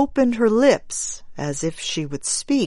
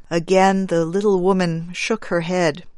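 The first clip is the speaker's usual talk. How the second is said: usual speaking voice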